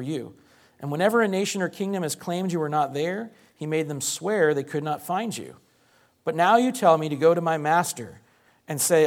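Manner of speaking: earnest, rising Bible reading with feeling; emphatic scripture reading that climbs toward a cry; dramatic scripture reading. Acted. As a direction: read the passage as a frightened servant pleading. Reads scripture passage aloud dramatically.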